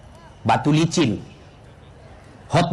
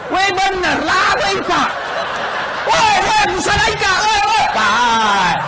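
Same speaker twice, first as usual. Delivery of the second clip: high, laughing mock shouts of weh; calling out; 9 semitones higher, slower than usual. Stranded preacher hails cars at night, high laughing calls. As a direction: hail the passing cars, half laughing.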